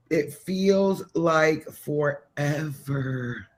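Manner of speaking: very slow, stretched-out "feels like forever"; half-speed, lingering emphasis; drawn out. Real, not acted. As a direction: drag out each word as if it never ends. Stretches words to show endless wait.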